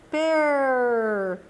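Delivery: "Per" with a long, strong R. Shown as a demonstration. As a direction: hold the R at the end of "Per". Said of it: "Olha como ele fica forte".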